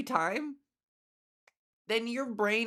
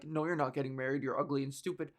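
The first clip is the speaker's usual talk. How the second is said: a lightly voiced lower, faster taunt; mock comeback; 7 semitones lower, 4 dB quieter, faster than usual. Her hypothetical comeback to trolls, low mock taunt. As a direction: toss off the insult in a low mock sneer. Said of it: "my response, hypothetically in this situation".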